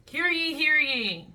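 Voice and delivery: mock town crier call. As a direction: announce it like a herald. Proclaiming agreement.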